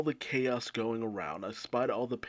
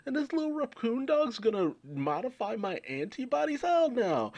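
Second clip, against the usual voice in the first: high, outraged squawk; villain shrieking at the raccoon dog, outraged; Queen freaking out; 14 semitones higher than usual. Retold or imitated. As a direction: shriek like a villain who can't believe it. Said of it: "And Queen's just like".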